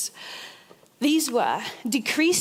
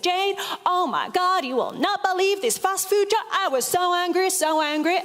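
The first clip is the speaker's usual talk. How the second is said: higher-pitched, hammed-up American accent, mock outrage; terrible American accent; 6 semitones higher than usual. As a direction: imitate an indignant American friend with a bad accent. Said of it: "my terrible American accent".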